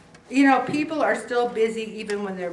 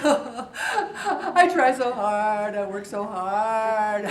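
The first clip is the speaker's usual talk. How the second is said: slow, drawn-out poor-me whining; milk it like a sulking martyr; self-pity whine; much slower than usual. Retold or imitated. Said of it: "I have a pity party".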